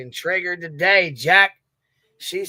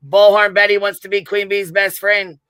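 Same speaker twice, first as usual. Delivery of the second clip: loud jeer; 5 semitones higher, 8 dB louder than usual. Acted.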